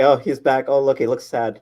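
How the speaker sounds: usual speaking voice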